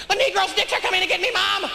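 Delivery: put-on very high, fast child's wail for Mom; mock panicked kid, loud and high; kid waking from nightmare. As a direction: cry out like a child waking terrified. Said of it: "Kids gonna be waking up like this".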